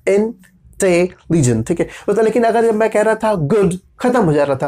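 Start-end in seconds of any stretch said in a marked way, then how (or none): 0.1-1.7 इंटेलिजेंट slowly broken into इं-टे-लि-जेंट; slow syllable-by-syllable इंटेलिजेंट; split into syllables. Shown as a demonstration. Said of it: "अब देखिए divide हुआ ना".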